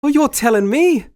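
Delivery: high-pitched character voice; Sammy. Acted. Walking up to join the watching [name], high put-on voice. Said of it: "Sammy will walk up to me and he'll go".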